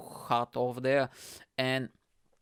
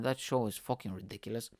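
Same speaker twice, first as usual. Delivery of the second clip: ordinary delivery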